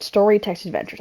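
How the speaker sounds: ordinary talk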